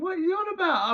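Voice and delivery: squeaky can't-believe-it query; laughing at the absurd offer; amused disbelief. Retold or imitated. Laughing at the inmate.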